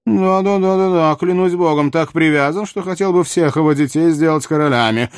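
high, rattled-off royal exclamation; sarcastically agreeing monarch, high and animated; exclaiming king. A narrator's reading. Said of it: "воскликнул Людовик".

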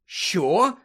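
Harry exclaiming at Dobby; startled exclaim. Acted. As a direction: blurt it out in disbelief. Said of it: "вигукнув Гаррі".

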